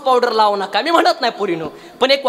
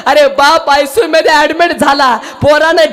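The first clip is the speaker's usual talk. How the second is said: loud, slow, lamenting kirtan delivery; preaching lament; 3 semitones higher, 4 dB louder, slower than usual. Acted. Preacher lamenting the ruined family to the crowd, slow and loud. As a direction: mourn the loss before the crowd.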